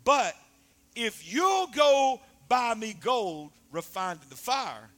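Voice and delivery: loud, slow, shouted preacher's cry; full-force preaching. Acted. Preacher rousing the congregation, loud shouted preaching. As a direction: thunder it out to the church.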